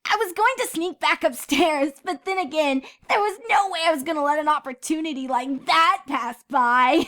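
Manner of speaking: put-on high, light young pony girl voice; a playful filly teasing her friend. A narrator's reading. Scootaloo teasing Sweetie Belle, high filly voice.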